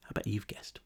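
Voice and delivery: soft conspiratorial whisper; whispered aside. Real, not acted. Narrator teasing the listeners in a whisper.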